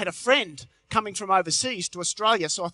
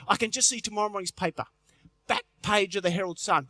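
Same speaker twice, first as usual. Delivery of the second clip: ordinary delivery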